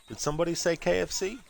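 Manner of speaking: high, sing-song ad character voice; cheery ad voice. Retold or imitated. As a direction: pitch it up like a bright commercial. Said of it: "they're like".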